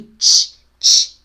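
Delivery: "tch" as a loud burst of consonant, no vowel; isolated tch sound. Shown as a demonstration. Teacher isolating the sound, loud consonant burst. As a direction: fire out "tch" on its own. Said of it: "é esse aqui".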